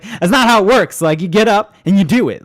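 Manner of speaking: loud, high exasperated rant; husband loudly scolding his sleepy partner; retold exasperated rant. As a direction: lay down the law in a mock argument. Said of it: "And I was like".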